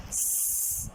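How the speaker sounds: hissed स, just the sound; letter S sound. Shown as a demonstration. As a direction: say स as a pure unvoiced hiss. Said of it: "What is the sound of letter S?".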